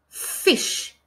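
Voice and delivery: Fish breathed with no voice, the f hissed long; whispered Fish. Shown as a demonstration. Teacher modelling the f sound in Fish for children, whispered. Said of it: "Can you hear the f?".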